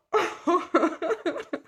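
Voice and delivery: gleeful laugh. Real, not acted. Laughs after greeting.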